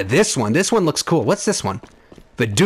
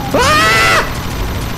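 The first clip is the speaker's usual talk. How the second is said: very high, sustained shriek of fright; real startled gamer scream. Real, not acted. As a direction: let out a long, piercing scream. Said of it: "Sorry for all the screaming".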